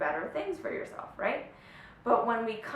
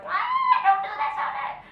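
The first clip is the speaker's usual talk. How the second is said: very high, loud squeal of panic; freaking-out granny lizard; 7 dB louder than usual. Pretend. Coach mimics the silly fear voice as a tiny granny lizard, shrieking in comic panic. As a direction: screech it like a tiny hysterical lizard. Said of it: "little granny snake or lizard that's freaking the fuck out".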